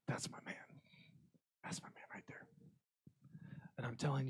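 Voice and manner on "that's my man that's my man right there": hushed, awed whisper; proud whisper. Retold or imitated. God seeing Moses is His leader, proud whisper. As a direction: whisper it like a private boast.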